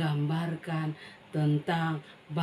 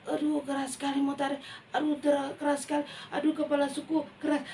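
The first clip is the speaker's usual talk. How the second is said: put-on higher, fast child voice; straining child; 7 semitones higher, faster than usual. A hint of the child straining at the rope. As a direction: complain as it won't budge.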